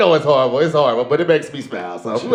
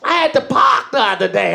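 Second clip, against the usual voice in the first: higher-pitched imitation Boston accent; mock Boston accent; 9 semitones higher than usual. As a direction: imitate a heavy Boston local. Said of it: "She's so Boston, too".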